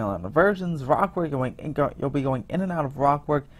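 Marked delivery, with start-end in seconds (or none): none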